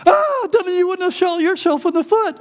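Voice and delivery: high, loud squealed retort; imitated yelling. Boy yelling back at his hurt brother, high mock yell. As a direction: yell back in comic pain and outrage. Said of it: "then I started yelling".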